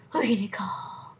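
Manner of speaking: soft whispered tease. Pretend. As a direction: whisper it like a secret. Whispered joke.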